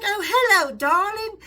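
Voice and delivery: put-on posh English accent. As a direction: coo it like a refined English woman. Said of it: "I heard a tiny little English voice".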